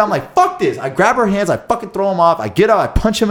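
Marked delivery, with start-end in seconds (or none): 0.3-0.8 high-pitched, exasperated mock anger; retold pissed-off outburst. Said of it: "I got so pissed".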